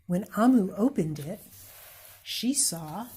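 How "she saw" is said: usual speaking voice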